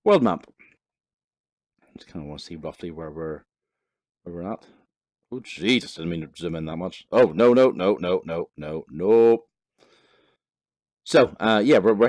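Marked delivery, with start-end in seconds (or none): none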